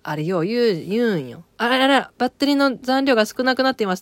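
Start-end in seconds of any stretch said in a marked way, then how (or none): 1.6-4.0 high, quick mock automated voice; phone announcement voice. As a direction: imitate the phone's battery warning, brisk and loud. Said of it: "って今かかっとったわ". (機械音声をまねて早口で)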